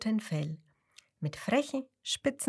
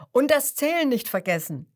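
louder, emphatic grandpa reminder; 4 semitones higher, 7 dB louder, faster than usual. Acted. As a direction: press the point home. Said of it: "erklärt Opa Fuchs".

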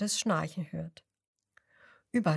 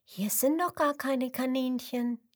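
put-on child voice; 4 semitones higher than usual. Lisa objects, far timbre.

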